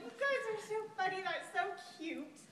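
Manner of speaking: high, excited gushing; gushing delight. Retold or imitated. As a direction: squeal it like a delighted friend. She gushes at the group.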